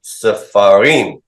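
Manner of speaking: Sefarim drawn out slowly, stress landing on rim; slow model of final stress. Shown as a demonstration. Teacher drilling class on Sefarim, slow final stress. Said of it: "Don't stress that first syllable".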